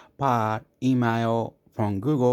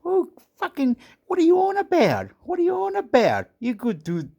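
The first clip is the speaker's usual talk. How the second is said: squeaky mock outrage; 3 dB louder than usual. Squeaky disbelief, much higher.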